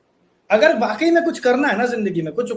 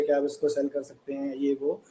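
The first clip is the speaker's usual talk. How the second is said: soft pleading ask; 8 semitones lower, 8 dB quieter than usual. Retold or imitated. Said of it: "लेके गए थे कि मेरी डिश है".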